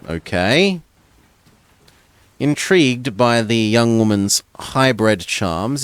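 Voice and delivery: normal talking voice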